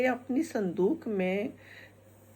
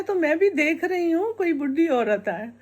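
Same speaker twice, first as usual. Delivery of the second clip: mother-in-law; 7 semitones higher, 4 dB louder than usual. Acted. Mother-in-law insists in argument.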